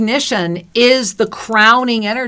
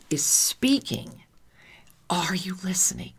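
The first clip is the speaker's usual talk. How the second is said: whispering; 3 semitones lower, 8 dB quieter than usual. Real, not acted. Telling listeners the universe is speaking, whispering. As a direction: drop to a whisper, letting each word land. Hushed climax of spiritual message.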